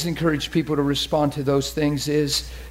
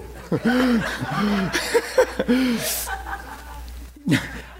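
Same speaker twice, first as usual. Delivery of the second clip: helpless chuckling at own joke; real drawn-out laughter; long laugh; 4 semitones higher, 3 dB quieter than usual. Real, not acted. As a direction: laugh it out at length.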